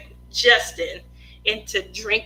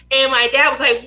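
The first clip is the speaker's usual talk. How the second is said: ordinary delivery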